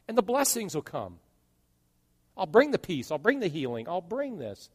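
high, loud, rising preacher's cry; full-force preaching. Acted. Pastor preaching hard to the congregation about hurt, fervent.

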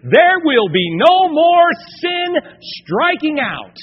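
loud, high rallying cry, drawn out; soaring shout at the sermon's peak; preaching climax. Real, not acted. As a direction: belt it out to the congregation.

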